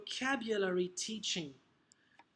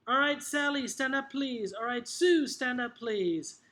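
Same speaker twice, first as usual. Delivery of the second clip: higher, projected teacher voice; single out pupils with a clear call; 4 semitones higher, 4 dB louder than usual. Acted. Teacher picking students to stand, calling out.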